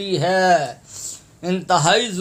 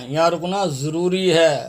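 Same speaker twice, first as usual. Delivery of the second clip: ordinary talk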